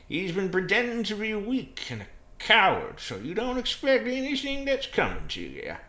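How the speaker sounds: loud, high, hammed-up character voice; dramatic accuser. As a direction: drive the warning home at full volume. America dramatically reveals a betrayal.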